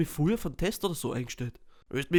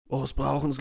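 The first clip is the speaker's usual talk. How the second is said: ordinary delivery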